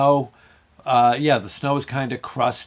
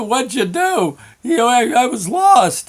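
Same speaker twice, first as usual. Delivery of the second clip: put-on high, complaining kid voice; upset child; 9 semitones higher, 4 dB louder than usual. Kid to father after crash, upset protest. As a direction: protest like an aggrieved little boy.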